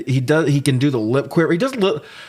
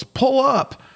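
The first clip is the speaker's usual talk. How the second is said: a sudden, much higher mock yell of "Pull up"; retold yell. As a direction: shout the pilot's warning high and sharp. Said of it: "as Maniac yells".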